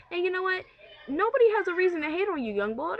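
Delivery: high, loud and fast; worked-up outburst. Retold or imitated. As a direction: fire it back at him, fired up. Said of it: "That's exactly what I said".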